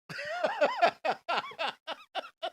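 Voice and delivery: shrieking laugh; high cackling laugh. Real, not acted. Laughing at own joke.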